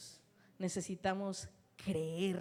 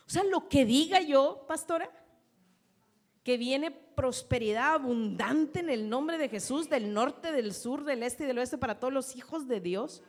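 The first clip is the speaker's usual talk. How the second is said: loud, raised declaration of blessing; preaching declaration; 4 semitones higher, 6 dB louder than usual. Acted. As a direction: proclaim abundance over the church. Declaring prosperity over congregation.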